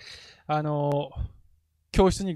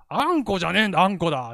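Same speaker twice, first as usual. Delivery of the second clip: mock scolding; 4 semitones higher, 6 dB louder, faster than usual. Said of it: "っつって".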